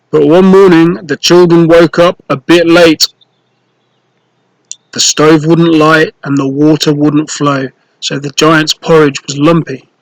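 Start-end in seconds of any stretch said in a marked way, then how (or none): none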